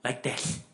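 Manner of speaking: imitated lisp on "this"; lisping imitation. Preacher showing his congregation the man's speech impediment, lisping. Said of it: "couldn't talk without lisping like this".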